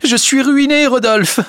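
loud, very high wail; sobbing, desperate outburst; bursting into sobs. A narrator's reading. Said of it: "Elle éclata en sanglots".

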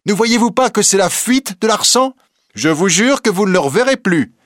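put-on high, insistent young reporter; impassioned young reporter. A narrator's reading. Reporter argues Larsan's flight to the court, impassioned. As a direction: press the point with fervour.

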